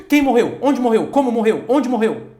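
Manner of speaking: mock curious busybody; eager nosy questions. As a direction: pounce on the news like a gossip. Hungry gossip questions acted out.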